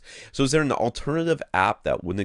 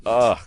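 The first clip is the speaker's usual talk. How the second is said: louder, higher exasperated "ugh"; frustrated groan; 5 semitones higher, 6 dB louder than usual. Real, not acted. Host struggling to navigate left-handed, frustrated groan. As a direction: groan in real annoyance at a clumsy task.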